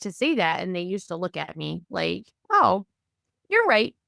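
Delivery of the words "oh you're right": high-pitched mock realization; dawning realization. Staff conceding to the insistent mother, mock realization. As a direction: imitate them conceding, pitched way up. Acts out staff's reaction to her.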